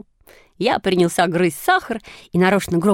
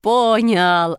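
pleased, drawn-out cry at near-usual pitch; delighted exclamation; 2 semitones higher than usual. A narrator's reading. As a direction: stretch it out with contained joy. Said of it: "обрадовался Шишкин". (радостно, протяжно)

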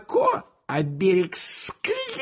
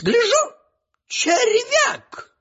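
excited exclamation; 4 dB louder, slower than usual. Acted. Excited tale of finding worm.

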